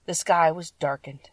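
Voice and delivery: ordinary delivery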